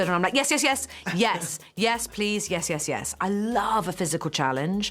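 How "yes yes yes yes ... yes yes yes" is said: high, loud, eager yes yes; eager yeses. Retold or imitated. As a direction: gush yes over and over, laughing. Eager retold repeated yeses.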